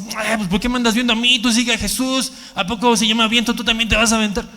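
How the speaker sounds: a hint of a defensive churchgoer, fast; mock defensive Hugo. Hugo brushes off someone copying him, mock defensive and fast. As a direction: brush them off, quick and a little offended. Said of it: "Hugo va a decir".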